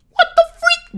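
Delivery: very high, loud squeal; squeaky exclamation. Acted. Streamer stunned by donations, loud high squeal. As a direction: squeak it out at the very top.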